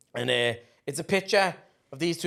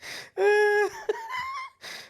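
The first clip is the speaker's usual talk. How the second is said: laughter cracking very high; a real squeal of laughter; shrieking laugh. Real, not acted. Punchline of a joke.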